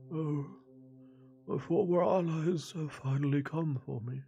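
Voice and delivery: put-on a confused old man, drifting; frail old man.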